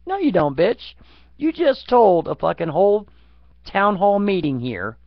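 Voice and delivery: a loud, heated scolding of a hypocrite; angry telling off. Retold or imitated. Scolding an imagined politician, loud and angry.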